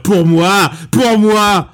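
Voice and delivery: imitated boxer's shout; mock triumphant shout. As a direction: shout it out like a hammed-up film boxer. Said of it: "il veut dire".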